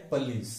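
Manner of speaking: पुलिस as puh-LEES; weak first vowel in पुलिस; correct pronunciation. Shown as a demonstration. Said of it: "सही क्या है".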